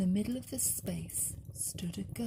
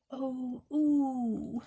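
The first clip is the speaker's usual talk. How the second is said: a higher, louder comforting ooh; middle bear ooh; 5 semitones higher, 5 dB louder than usual. Acted. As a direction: croon it like a middle-sized teddy bear. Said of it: "and the middle one said".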